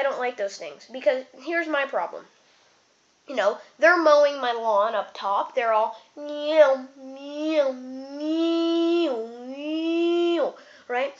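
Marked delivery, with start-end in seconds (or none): 6.2-10.5 long whining motor noise; imitated clipper buzz. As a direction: mimic clippers passing over the head. Imitates clippers mowing his head.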